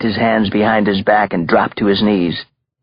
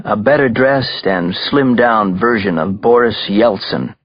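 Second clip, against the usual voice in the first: ordinary delivery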